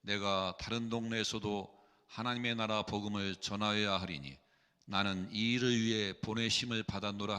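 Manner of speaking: ordinary talk, nothing marked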